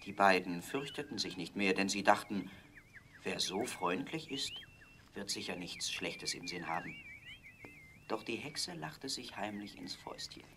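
ordinary delivery, nothing marked

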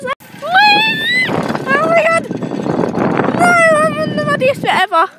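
high, loud excited whee; real thrill of the ride; thrilled squeal. Real, not acted. Riding down, thrilled.

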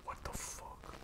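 barely voiced, hushed; tiny stunned whisper; frightened whisper. Real, not acted. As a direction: whisper it in dread. Frightened by something nearby.

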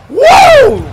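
loud, very high whoop; cheering woo. Real, not acted. Fan celebrating a big move, loud whoop.

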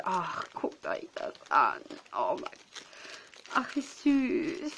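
cooing over cute gift. Real, not acted. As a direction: melt over something cute, slowly.

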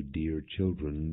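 usual speaking voice

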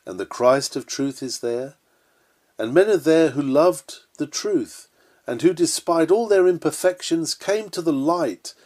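ordinary talk, nothing marked

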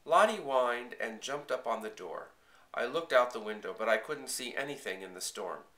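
usual speaking voice

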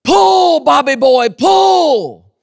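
high shouted farmer's holler; hollering at a mule. Retold or imitated. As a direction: yell at the mule to pull. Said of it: "and he'd say".

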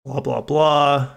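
slow, dismissive drone; bored mock drone; mock blah blah. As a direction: wave off the corporate quote with boredom. Skipping the rest of a press-release quote.

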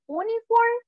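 high-pitched mock "Uniform" starting with oo; wrong Spanish-style U. Shown as a demonstration. Teacher mocking the wrong reading, high "Uniform" with plain oo. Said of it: "No decimos uniform, ¿verdad?".

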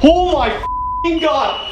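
high, slow, drawn-out yell of real shock. Real, not acted. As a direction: yell it out, stunned by the reply. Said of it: "I'm sorry for yelling".

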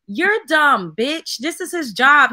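loud, fed-up snap at the hater; furious rant at a dumb comment; angry outburst. Real, not acted. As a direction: yell it back at the commenter. Ranting back at a commenter.

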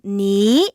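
니 rising straight up like a question; second-tone demonstration. Shown as a demonstration. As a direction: sweep 니 upward as if asking why. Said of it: "그 상태로". (니를 왜처럼 올리는 2성으로)

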